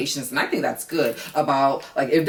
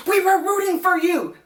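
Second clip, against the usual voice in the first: high, louder mock disappointment; mock scolding; 4 dB louder than usual. Telling Clay off for letting A D down, high and mocking. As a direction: scold Clay with sarcastic pity. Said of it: "to dumb Clay".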